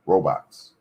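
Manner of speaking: normal talking voice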